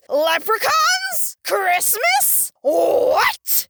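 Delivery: high, drawn-out questions, each word separate; baffled disbelief. Acted. Host baffled by leprechauns at Christmas, high stretched questions.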